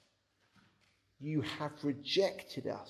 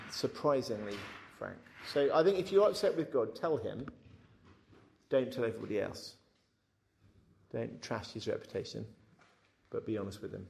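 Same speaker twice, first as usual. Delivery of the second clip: ordinary talk, nothing marked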